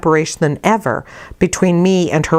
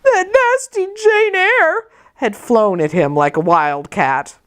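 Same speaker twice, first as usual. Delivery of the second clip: high, slow whiny child voice mimicking a telltale; lightly voiced blubbering boy; blubbering tattle; 7 semitones higher, slower than usual. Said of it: "in a blubbering tone".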